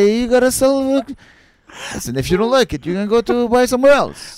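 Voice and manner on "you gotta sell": high, slow, put-on salesman voice; mock pushy seller. Friend playing a price-gouging water seller. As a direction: hawk it like a greedy street vendor.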